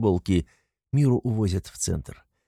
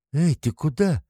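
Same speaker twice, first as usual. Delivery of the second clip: woman's voice; 7 semitones higher than usual. A narrator's reading. Brunette calls after him.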